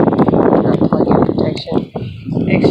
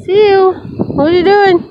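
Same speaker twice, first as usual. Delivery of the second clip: pet talk; 6 semitones higher than usual. Real, not acted. Owner greeting her dog on the beach, cooing. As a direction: coo at the pup, pitch lifted.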